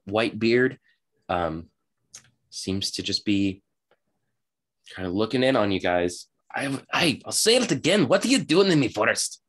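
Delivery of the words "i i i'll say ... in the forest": high-pitched character voice, insistent; indignant halfling. Acted. Halfling questioning intruders in the forest, indignant. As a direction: demand an answer as a put-out little halfling. Game master plays the halfling.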